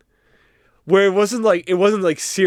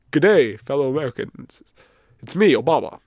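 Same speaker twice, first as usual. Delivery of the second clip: halting, lightly voiced presidential impression; a half-hearted politician's address with long pauses; Obama; 4 semitones lower than usual. Guest doing a bad impression on request, halting statesman. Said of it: "Can you give your Obama impression?".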